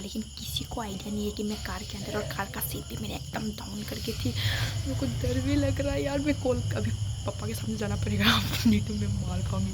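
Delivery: normal talking voice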